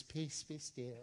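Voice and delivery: very soft, hushed and mild; gentle soft-spoken. Retold or imitated. Preacher mocks the King James reading, very soft. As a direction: say it sweetly, almost too gently. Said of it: "I don't like the King James".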